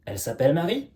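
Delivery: "Elle s'appelle Marie" with a rising tune at the end of "Marie"; question intonation. Shown as a demonstration. Teacher turning a statement into a question for students, rising intonation on "Marie". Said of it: "Question maintenant et intonation".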